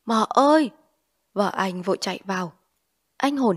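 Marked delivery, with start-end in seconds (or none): 0.1-0.7 loud call to his wife. Acted. Husband calling his wife, loud call. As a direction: call her urgently from the room. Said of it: "ngồi nhổm dậy gọi".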